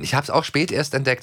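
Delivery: ordinary talk, nothing marked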